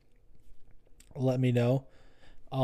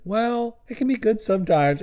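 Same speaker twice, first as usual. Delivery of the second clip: high, lightly voiced comedian brushing off critics; a hint of Rogan shrugging it off; mock Rogan defense; 9 semitones higher than usual. Comic mocking Rogan's stand-up critics, in a high mock voice.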